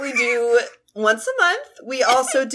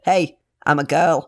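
a rough attempt at Australian; mock Australian bloke, deliberately bad; lower, badly faked Australian voice; bad Australian accent; 8 semitones lower than usual. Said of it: "really bad Australian accent".

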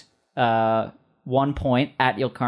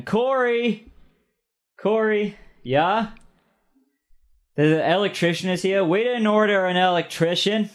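cashier calling out high, then manager answering higher; two voices; 8 semitones higher than usual. Acted. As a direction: shout for Corey in a raised voice, then answer as Corey. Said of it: "two different voices that you're doing".

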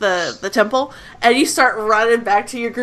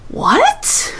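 high startled what; surprised and baffled; confused; 13 semitones higher than usual. Acted. Target confused by telepathic voice.

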